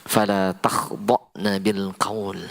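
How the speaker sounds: slow, measured recitation of the verse; Quran recitation. Shown as a demonstration. Preacher reciting the verse to the women, slow. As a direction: recite it slowly and carefully. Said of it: "Pegang ini baik-baik".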